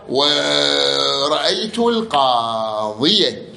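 "القاضية" تُنطق بياء مفتوحة ممدودة، ببطء شديد; مدّ "القاضية" ببطء كأنك تملي; مثال منطوق ببطء. Shown as a demonstration. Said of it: "شو تقول". (مثال النصب بالياء مفتوحة ببطء)

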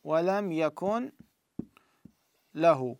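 slow Quranic recitation of ولم يكن. Shown as a demonstration. Teacher reciting from Surat al-Ikhlas. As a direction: recite it slowly, drawn out. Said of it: "مثلًا في سورة التوحيد".

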